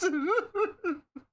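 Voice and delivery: squealing laugh, almost crying; short squeal of laughter; high laugh. Real, not acted. Laughs about Tomba re-release.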